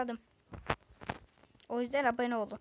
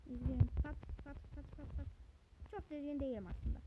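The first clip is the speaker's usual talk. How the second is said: ordinary talk, nothing marked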